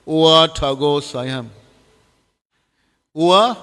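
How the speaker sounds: drawn-out mock mantra. Preacher quoting a bogus guru's mantra, drawn out. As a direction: chant the nonsense mantra slowly and solemnly. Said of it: "this one guru gave this mantra".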